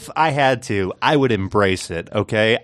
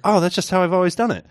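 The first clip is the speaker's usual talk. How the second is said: fast, high, offhand explanation; breezy husband; 9 semitones higher, faster than usual. Retold or imitated. Husband casually explaining himself to wife, quick high voice. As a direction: toss it off like it's obvious. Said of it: "And he goes".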